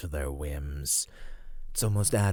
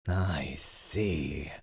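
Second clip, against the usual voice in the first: ordinary delivery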